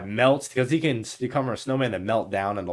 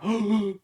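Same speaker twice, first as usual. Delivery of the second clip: 1 mock gasp; 10 semitones higher than usual. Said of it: "He's like".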